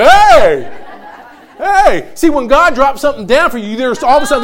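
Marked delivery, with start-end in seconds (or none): 0.0-2.1 high, loud excited "hey"; excited dog voice. Retold or imitated. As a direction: call out like a happy dog. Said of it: "He's like".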